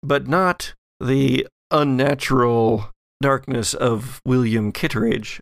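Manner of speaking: ordinary delivery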